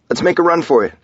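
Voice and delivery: high, loud urging; urgent call. A narrator's reading. Husband grabbing her hand in the rain, urgent call.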